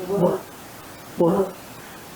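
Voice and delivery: soft unvoiced "Wolf" with rounded lips; breathy wolf sound. Shown as a demonstration. Teacher showing the frightened w sound. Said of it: "Can you say wolf".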